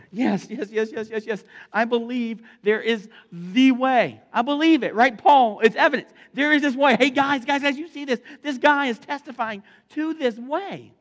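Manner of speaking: loud, high, thrilled proclamation; excited shout. Acted. Imagined new believer calling the guys over, excited shouting. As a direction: shout it out like an instant convert.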